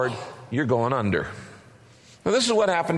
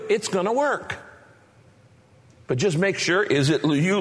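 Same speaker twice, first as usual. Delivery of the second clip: ordinary talk, nothing marked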